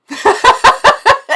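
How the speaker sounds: real high laugh bursting out; high burst of laughter. Real, not acted. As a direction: laugh delightedly at the joke. Laughing at the scene.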